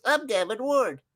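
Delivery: high, silly mocking referee voice; Gavin Ward. Fan demonstrating voice imagined for referee, high mocking squeak. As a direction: pitch it way up to ridicule the ref. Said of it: "that's the voice I hear when I look at his face".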